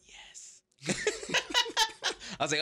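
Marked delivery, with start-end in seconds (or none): 0.1-0.6 hushed, voiceless staff reply; imitated whisper. Staff confirming who is on stage, imitated whisper. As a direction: whisper it like a secretive usher. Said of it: "Everyone's just whispering".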